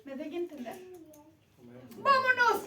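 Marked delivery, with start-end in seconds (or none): 2.0-2.7 loud, high shout of come on; an eager, rallying call to go. Acted. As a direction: yell it to pull your brother along. Urging brother to come along.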